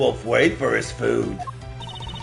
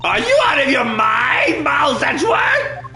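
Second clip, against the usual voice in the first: angry shout; 12 semitones higher, 4 dB louder than usual. Acted. Character shouts at prosecutor.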